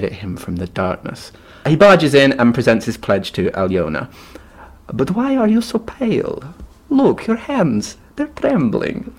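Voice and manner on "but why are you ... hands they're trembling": put-on high, creaky old crone; old woman voice. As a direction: voice the suspicious old pawnbroker.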